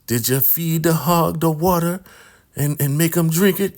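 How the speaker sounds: mock elder woman's high voice; high-voiced questioning elder. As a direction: interrogate like a suspicious elder. Elder Mother questions about the hog.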